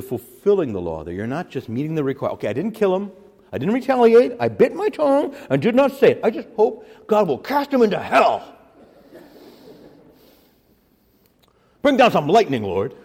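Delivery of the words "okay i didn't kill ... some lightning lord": high, loud sarcastic self-righteous grumble; mock resentful believer. Preacher mimicking a believer wishing hell on his enemy, high and loud. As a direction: ham up a believer praying for revenge.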